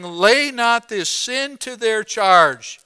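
high, loud, slow pulpit cry. Retold or imitated. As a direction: cry it out slowly as a dying plea. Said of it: "cried with a loud voice".

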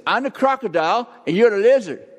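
put-on girl's voice. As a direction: granddaughter announcing the game, high. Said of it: "and she says".

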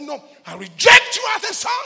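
loud, high mock shouted rejection; preacher acting out being disowned; retold harsh refusal. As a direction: bark the refusal like the man did.

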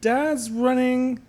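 high and slow, halting; piecing it together slowly; high, slow retelling. Retold or imitated. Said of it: "And I was like".

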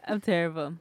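normal talking voice

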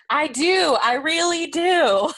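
a patronizing, too-eager vow; overeager I do. Retold or imitated. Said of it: "he's just like".

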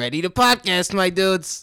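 high, loud surfer dude voice; bro dude voice. Pretend. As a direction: hype it up like a goofy dude. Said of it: "say, dude, do it real quick".

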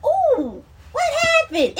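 high, laughing ooh of amazement; amazed at herself. Retold or imitated. Reacting to her own old prayer videos with laughing amazement. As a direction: squeal it in delighted disbelief. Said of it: "And I'm like".

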